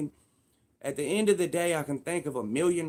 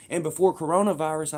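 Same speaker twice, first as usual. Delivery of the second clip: usual speaking voice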